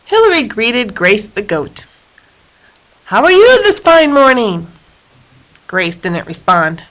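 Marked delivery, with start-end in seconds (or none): 3.1-4.7 cheerful greeting. A narrator's reading. Hilary greets Grace.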